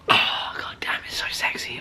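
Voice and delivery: breathy whispered awe; awed whisper. Retold or imitated. Seeing the spoiler photo, whispered awe. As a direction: whisper it like it's too beautiful.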